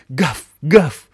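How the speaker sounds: loud playful woofs; barking. Acted. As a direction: bark it out like a big dog. Пёс лает. (громкий лай)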